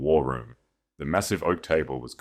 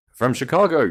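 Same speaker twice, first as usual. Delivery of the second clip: surprised question; 9 semitones higher, 8 dB louder than usual. Acted. Connor reacts to the letter.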